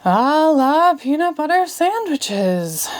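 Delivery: weary sighing spell, drawn out; a tired magician's sighed incantation; sighed wearily. A narrator's reading. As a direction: sigh the spell out, tired of trying. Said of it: "Mumphy sighed wearily".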